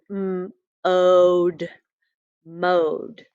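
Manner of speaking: "m" then "ode" then "mode"; sounding out mode. Shown as a demonstration. Teacher blending the word "mode" for the class. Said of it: "This is the word".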